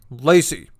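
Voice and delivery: loud call across the house. Retold or imitated. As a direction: call the cat's name loudly. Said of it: "And then your dad".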